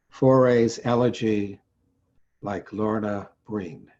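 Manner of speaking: measured, solemn elegy read aloud; poetry reading. Acted. Poet reads a poem for Lorna Breen, solemn recitation. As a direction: recite it with deliberate, weighted phrasing.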